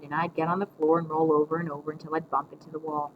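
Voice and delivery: usual speaking voice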